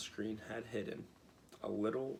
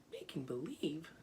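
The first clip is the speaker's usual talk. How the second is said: surprised cry; 7 semitones higher than usual. Acted. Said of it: "cried Dorothy".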